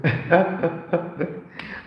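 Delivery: high wheezing cackle imitating Eddie Murphy; hammed-up signature comedian laugh. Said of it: "laugh like Eddie Murphy too".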